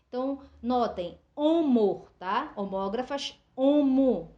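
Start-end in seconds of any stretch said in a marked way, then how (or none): 1.3-2.1 homo said loudly with a falling close; teacher hitting homo loud and clear; emphasized prefix. Shown as a demonstration. Teacher stressing the prefix for students, loud. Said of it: "quer dizer, é, igual". 3.6-4.4 homo said loudly with a falling close; teacher hitting homo loud and clear; emphasized prefix. Shown as a demonstration. Teacher stressing the prefix for students, loud. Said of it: "quer dizer, é, igual".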